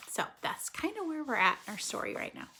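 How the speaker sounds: plain reading voice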